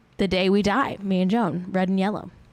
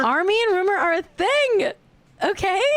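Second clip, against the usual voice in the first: much higher, emphatic gossip insistence; excitedly telling co-host the celebrity couple news; 11 semitones higher than usual. Acted.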